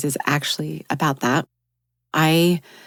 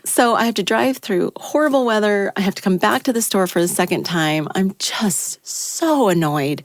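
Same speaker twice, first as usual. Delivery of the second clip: usual speaking voice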